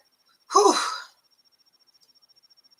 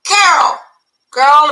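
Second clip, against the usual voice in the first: ordinary delivery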